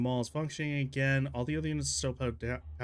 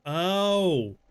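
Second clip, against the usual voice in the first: surprised; 5 semitones higher, 7 dB louder than usual. Real, not acted. Sudden discovery.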